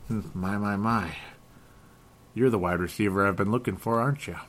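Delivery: put-on lightly done old detective voice; Joe Kenda voice. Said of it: "Joe Kenda there. I was just imitating that guy".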